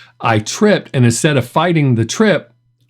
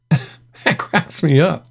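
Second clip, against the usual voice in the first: slow words squeezed through real laughter; barely getting it out while laughing; laughing while talking; 4 semitones higher, much slower than usual. Real, not acted. Telling viewers the clip cracks him up, through real laughter.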